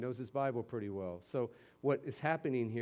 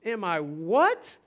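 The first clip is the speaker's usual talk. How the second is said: slow, louder, bewildered comeback; baffled; 5 semitones higher, 10 dB louder, much slower than usual. Retold or imitated. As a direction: play the stranger who can't believe his ears. Baffled reply to preacher.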